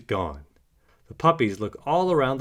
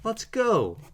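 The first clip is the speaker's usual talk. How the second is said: much higher, bright "let's go"; rally your brother to run; high excited puppy call; eager rallying call; 12 semitones higher than usual. A narrator's reading. Puppies rush off after Baby.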